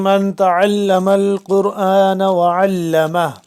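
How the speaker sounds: measured Arabic recitation; hadith recitation. Acted.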